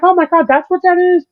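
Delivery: squeaky astonished realization; surprised lady. Retold or imitated. Narrator imitates the scooter lady discovering the speed dial, high and amazed. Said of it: "And she's like".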